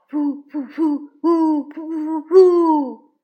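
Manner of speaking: loud, slow chicken imitation; mock chicken clucking. A narrator's reading. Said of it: "咯咯咯地叫着". (大声学鸡叫嘲弄)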